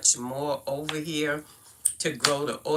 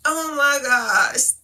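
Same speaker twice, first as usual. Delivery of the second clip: cracking up; 9 semitones higher, 8 dB louder than usual. Real, not acted. Cracking up over the accent.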